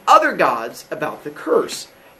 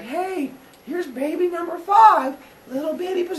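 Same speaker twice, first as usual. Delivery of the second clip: high sweet mother voice; 11 semitones higher, 3 dB quieter than usual. Acted. Rhea cooing as she hands over baby number five. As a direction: coo over the newborn.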